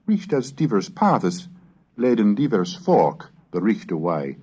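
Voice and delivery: steady recitation of Chaucer in period pronunciation; old English prose read aloud with care; Middle English reading. Acted. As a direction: read it as a medieval scholar would sound it.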